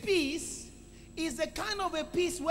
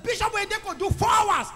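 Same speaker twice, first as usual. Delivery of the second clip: loud, raised rebuke; urging inner voice; 6 semitones higher, 6 dB louder than usual. Retold or imitated. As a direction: call out a shaming example to the sleepy one. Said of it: "Another voice said".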